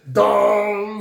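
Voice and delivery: loud, high cartoon dong; imitated "dong". Storyteller imitating a sudden realization, loud dong. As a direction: boom out the sound effect. Imitates sound of light bulb moment.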